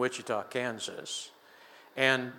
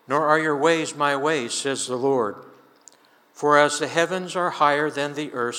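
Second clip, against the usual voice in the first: louder, preaching cadence on the Lord's words; scripture read with force; 6 dB louder than usual. Acted. Preacher reading Isaiah's words of the Lord aloud to his congregation, louder preaching cadence. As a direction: proclaim it like a preacher from the pulpit.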